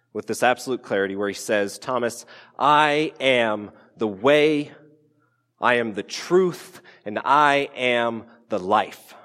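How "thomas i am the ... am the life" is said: slow, weighty declaration; solemn Jesus. Retold or imitated. Jesus telling Thomas the way, slow and solemn. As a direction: give each phrase full weight, slowly.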